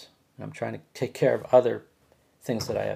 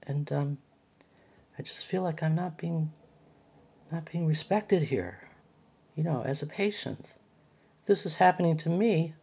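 higher-pitched, urgent, wounded pleading; upset plea; 6 semitones higher than usual. Retold or imitated. Patient pleading with the nurse about respect, higher, upset. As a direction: press your case, hurt and exasperated.

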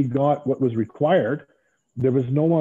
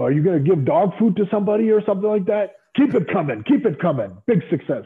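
excited boss praise; 8 semitones higher, 4 dB louder than usual. Retold or imitated. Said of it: "And he goes".

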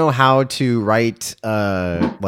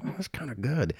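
much softer, understated aside; mock grudging approval; 3 semitones higher, 10 dB quieter than usual. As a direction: quietly admit it to yourself. Quoting own grudging approval of film.

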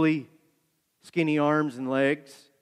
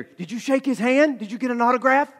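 higher-pitched, excited imitated questions; eager questioning; 8 semitones higher, 3 dB louder than usual. Cindy quizzing him about the meeting, mock-eager.

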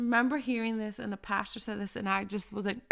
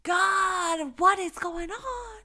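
much higher, whiny complaining voice; mock whiny complaint; 10 semitones higher than usual. Believer complaining to God that others are blessed, high mock whine. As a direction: play a frustrated believer whining to God. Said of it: "and you're just like".

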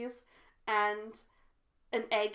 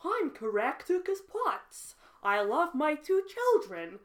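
Caractacus Potts; 3 semitones higher than usual. Acted. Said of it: "I sounded like Caractacus Potts".

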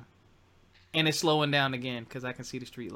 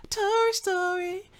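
high, slow drawn-out Tori's story; playful sing-song; slower than usual. Shown as a demonstration. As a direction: stretch it high and teasing. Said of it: "laughs, Tori's story".

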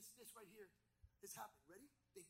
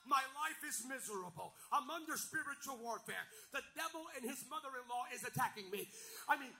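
loud, driving pulpit shout; full-force preaching; 7 semitones higher, 15 dB louder than usual. Acted. Pastor exhorting his church to stand firm, preaching at full force. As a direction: rouse the congregation, lifting pitch and volume toward the close.

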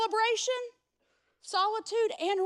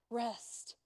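hushed; 6 semitones lower, 12 dB quieter than usual. Shown as a demonstration. A preacher answering what to do amid busy life, hushed. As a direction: drop "rest." to a near hush. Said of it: "In the middle of the most important things".

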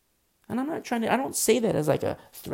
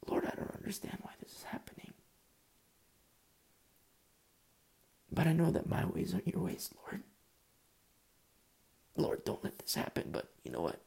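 hushed, half-whispered plea; anguished prayer; 4 dB quieter than usual. Acted. Believer on their face before the Lord, whispered anguished prayer. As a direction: pray it under your breath, surrendering. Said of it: "you're on your face before the Lord".

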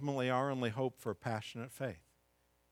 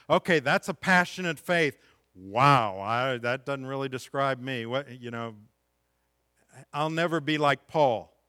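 mock discouraged listener; 2 semitones higher, 10 dB louder than usual. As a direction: play the listener who feels small next to Paul, raised and loud. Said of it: "and you say".

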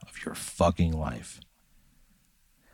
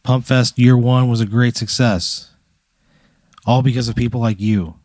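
raised voice for a crowd, slow; slow, higher speech voice; 4 semitones higher than usual. Acted. Pat tells the crowd from a table, slow speech voice. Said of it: "You give a little speech".

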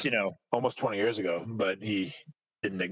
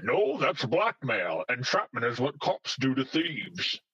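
slow Scottish impression, raised at first; Sean Connery; 2 semitones higher, slower than usual. Offering the other Entrapment trailer line, slow Scottish impression. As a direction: mimic the Scottish star, stretching each phrase. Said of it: "What I thought you were gonna say".

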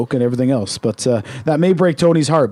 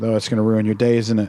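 mock sympathy; 6 semitones lower, 3 dB quieter than usual. Mock pity about cereal.

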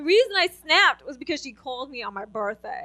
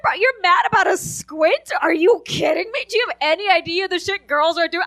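higher, louder mock disbelief; incredulous retort; 4 semitones higher, 4 dB louder than usual. Daughter answering criticism of her squint, incredulous retort. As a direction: fire back in disbelief.

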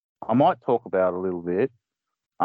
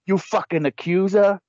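high, shrieking mock preacher; hammed-up high zealot rant; 8 semitones higher than usual. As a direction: rant it like a zealot accusing sinners. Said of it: "did they say".

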